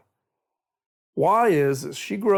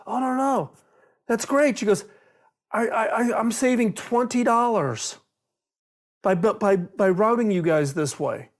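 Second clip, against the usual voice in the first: much higher, chirpy wife voice, stammering proudly; a bright mock-wife pleased with her bargain; cheerful wife; 6 semitones higher than usual. Wife proudly tells husband she saved twenty dollars, chirpy and high.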